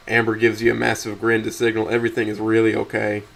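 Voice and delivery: ordinary delivery, nothing marked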